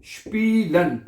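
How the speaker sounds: स्पीलन said with a clear "shp" onset, shpee-len; German pronunciation demo. Shown as a demonstration. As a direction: model the word स्पीलन slowly as "shpeelen". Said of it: "क्या है ये?".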